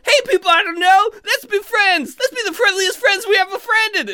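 high, loud, overeager friendliness; happy-talky drunk elf. Acted. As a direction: gush at strangers in a squeaky elf voice. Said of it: "I become the happy-talky drunk".

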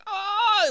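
high, drawn-out moan; long, high pitiful wail; crushed elf's groan. Acted. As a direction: groan in comic pain. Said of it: "he's crushed again".

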